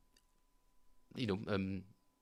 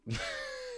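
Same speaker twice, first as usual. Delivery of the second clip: real squeal of laughter; high-pitched giggle; high squeaky laugh; 4 dB quieter than usual. Real, not acted. Laughs after failing Russian.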